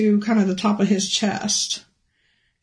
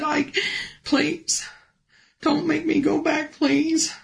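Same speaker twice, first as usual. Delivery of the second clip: higher, softer, begging please; near-tearful soft begging; desperate pleading; 6 semitones higher, 3 dB quieter, slower than usual. Retold or imitated. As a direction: plead not to be sent back. Begging Jesus not to send back.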